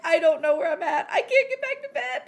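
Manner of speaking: high, loud, laughing wail; mock-helpless wail breaking into laughter; retold distress. Retold helpless moment in the night.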